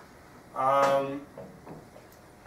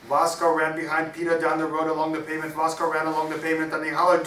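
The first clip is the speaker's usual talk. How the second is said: loud rhythmic recital; energetic story narration; 4 semitones higher, 9 dB louder, faster than usual. A narrator's reading. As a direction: drive the story along loudly. Reads story aloud at full voice.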